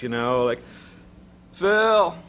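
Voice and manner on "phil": high, loud plaintive call; mock plaintive impression, pitched up; plaintive president. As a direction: call out like a needy, troubled president. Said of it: "I hear this plaintive voice".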